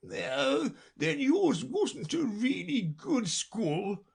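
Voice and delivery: high, slow, relieved turtle; relieved Mock Turtle. Mock Turtle comparing schools with Alice, relieved. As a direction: sigh it out with relief. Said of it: "in a tone of great relief".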